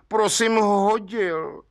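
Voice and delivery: high, slow contrite confession. A narrator's reading. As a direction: own up meekly, drawing it out high. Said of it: "řekl provinilec zkroušeně".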